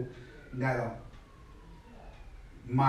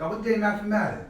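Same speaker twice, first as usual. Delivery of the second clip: questioning voice; 6 semitones higher than usual. Retold or imitated. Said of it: "God came ask me some questions like".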